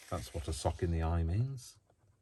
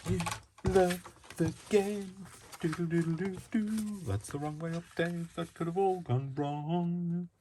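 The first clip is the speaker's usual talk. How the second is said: much higher, slow sing-song voice; silly character voice; 12 semitones higher than usual. Pretend. Talking to herself while crafting, high silly voice. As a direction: play a cartoonish little voice.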